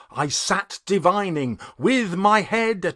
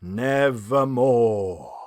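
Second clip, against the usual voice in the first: low, slow, otherworldly raven voice; ominous slow croak of a raven; raven's reply; 7 semitones lower, slower than usual. A narrator's reading. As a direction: drop deep and draw out the raven's one word. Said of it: "Then the bird said".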